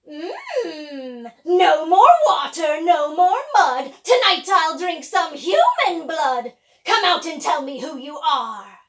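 loud, slow witch chant; gloating witch voice. Acted. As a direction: savour it like a hungry witch. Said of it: "raised her nose and sniffed".

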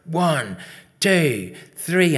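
loud, high counting call; shouted count. Acted. Girls counting in the skipper, shouting. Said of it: "all shouting".

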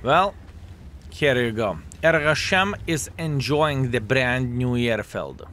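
ordinary talk

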